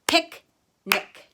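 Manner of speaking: "Picnic" loud and high, split pic, pause, nic; syllable by syllable. Shown as a demonstration. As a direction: punch both halves of "Picnic" separately. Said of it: "either of those syllables".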